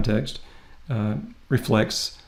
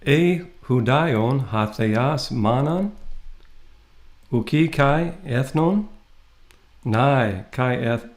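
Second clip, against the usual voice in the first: careful, measured reading of Greek; slow Greek recitation; slower than usual. Shown as a demonstration. Teacher reading a Greek verse to students, slow recitation. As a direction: sound out each Greek word slowly.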